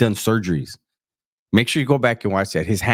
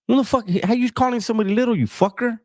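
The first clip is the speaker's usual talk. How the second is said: mock outrage; 12 semitones higher, faster than usual. Host mock-outraged at the story.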